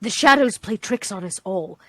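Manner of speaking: low, measured squirrel voice; subdued, low in-character musing; squirrel character, grave. Acted. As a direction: gravely report to the group in character. Player speaking in character.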